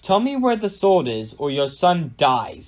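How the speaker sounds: threatening storybook villain, lightly snarled; snarled threat. A narrator's reading. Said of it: "snarled the stranger again".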